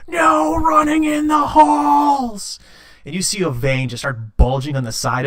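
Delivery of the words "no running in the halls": high, slow, drawn-out furious yell; dwarf screams down the hallway at a kid. Acted. Hall monitor yelling at running child, drawn-out scream. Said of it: "just starts screaming".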